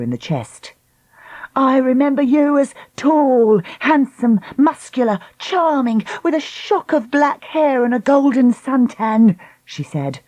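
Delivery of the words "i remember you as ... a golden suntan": high, sarcastic listing; mocking voice. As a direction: sneer through the list. Lucy taunts.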